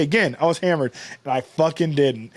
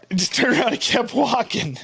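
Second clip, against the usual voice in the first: ordinary talk, nothing marked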